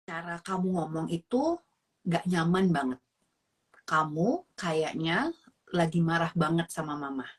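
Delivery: low, soft, measured reprimand with pauses; composed mother keeping her temper; calm parent. Shown as a demonstration. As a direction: set the boundary softly, pausing between phrases. Models a regulated parent reply.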